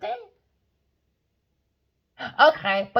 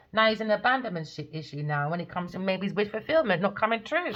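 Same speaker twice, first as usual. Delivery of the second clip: normal talking voice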